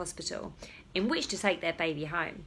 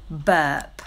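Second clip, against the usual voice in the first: usual speaking voice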